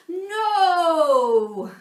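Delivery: high, loud cry of protest; knocked-over child crying no. Acted. A child bowled over by teachers, loud high wail.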